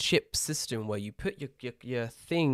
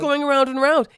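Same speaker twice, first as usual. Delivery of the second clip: exasperated; 11 semitones higher, 9 dB louder than usual. Acted. Exasperated over luggage.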